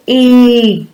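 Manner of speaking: "Dig" stretched out high, long vowel and released g; "Dig" drawn out high so each sound is heard; emphasised phonemes. Shown as a demonstration. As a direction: hold the i in "Dig", then pop the g. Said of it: "really emphasise those words".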